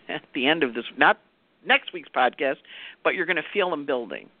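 ordinary delivery

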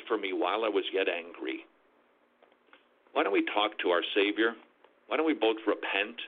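put-on low, gentle confession to a child; tender repentant parent. Preacher voicing a father confessing anger to his son, low and gentle.

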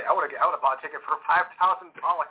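usual speaking voice

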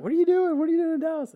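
high, surprised squeal of disbelief; cousin's shocked question. Retold or imitated. Cousin Jennifer on the phone, high shocked disbelief. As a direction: squeak it out, stunned he is here. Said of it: "she's like".

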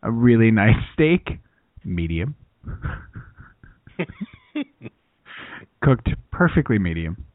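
very low, bland cooking host; halting, noncommittal TV chef; Chef Neutral. Acted. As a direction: present it deep-pitched and unexcited. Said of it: "everything Chef Neutral makes is, like, very bland".

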